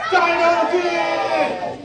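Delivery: long stretched high shout to lead the room; crowd shout. Shown as a demonstration. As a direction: yell it out slow and big with the crowd. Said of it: "Dino dig on three".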